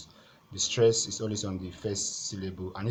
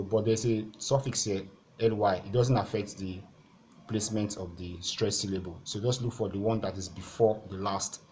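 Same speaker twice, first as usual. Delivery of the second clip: ordinary delivery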